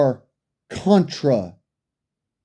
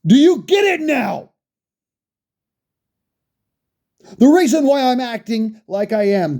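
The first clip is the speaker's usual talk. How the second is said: raised, heated voice building to a shout; angry rant; 6 semitones higher than usual. Real, not acted. Ranting at capitulating gun owners, heated shouting. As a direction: let the anger boil over at them.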